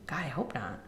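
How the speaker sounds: hushed retold whisper; a whispered, worried aside. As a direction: breathe it out like a frightened secret. Mom's hushed reaction, retold.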